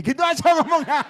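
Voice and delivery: high, loud laughing aside. Real, not acted. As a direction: laugh through the words. Laughing at the couple's request.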